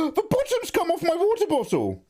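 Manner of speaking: high, startled cry of alarm; real panic over the bottle; sudden high alarm; alarmed shout. Real, not acted.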